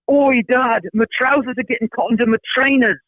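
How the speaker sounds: put-on high-pitched British child calling out; mock yell in a British accent; British kid yelling. Son yelling to dad at bus, high British accent. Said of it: "I hear him yelling from behind me".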